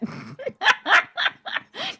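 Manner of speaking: squealing high laugh. Real, not acted. Streamer laughs at own joke.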